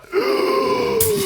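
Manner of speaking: sharp high gasp of shock; a big high intake of breath. Retold or imitated. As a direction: gasp as the huge bill lands. Everyone reacts to huge bill.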